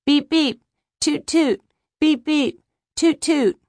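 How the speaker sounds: put-on high little-boy beeps and toots; playful child's honking. A narrator's reading. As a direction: imitate horn noises like a child at bath time. Boy playing noises in the bath.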